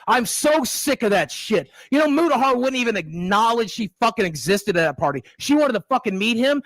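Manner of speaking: angry rant. Real, not acted. Boogie raging at the critic about his girlfriend. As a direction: hammer each curse home.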